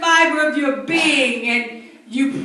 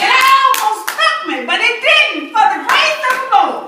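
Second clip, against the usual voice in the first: preaching shout; 7 semitones higher, 4 dB louder than usual. Real, not acted. Testimony climax before congregation.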